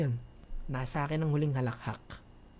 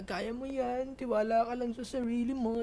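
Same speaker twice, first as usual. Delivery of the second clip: higher, sing-song mock encouragement; mock cheer; 7 semitones higher than usual. Mocking the corny encouragers, sing-song pep talk. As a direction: parody the cheesy pep talk. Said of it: "Hindi ako namomotivate sa".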